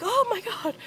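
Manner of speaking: very high, alarmed retelling; mock a dad's shocked outburst; imitating panicked dad. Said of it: "he's like".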